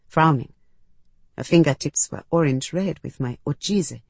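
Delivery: ordinary delivery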